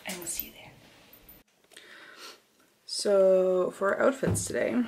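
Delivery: ordinary delivery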